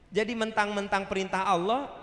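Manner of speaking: ordinary talk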